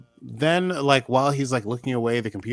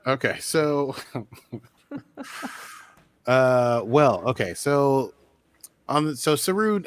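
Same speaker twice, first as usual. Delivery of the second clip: usual speaking voice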